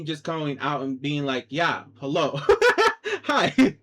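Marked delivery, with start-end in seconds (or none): 1.5-3.8 laughing greeting. Real, not acted. Vlogger finally coming out to say hello, laughing. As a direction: break into laughter while saying hello.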